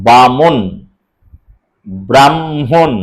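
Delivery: "বামন" as long "ba", then "mon"; syllable split. Shown as a demonstration. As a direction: divide "ব্রাহ্মণ" as "bram-hon", holding the closing consonants. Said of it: "বাকি যেগুলো বাধা পাচ্ছে".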